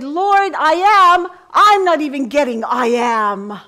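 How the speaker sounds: high, loud pleading prayer; desperate cry to God. Retold or imitated. Preacher retelling her loud plea to the Lord, high and loud.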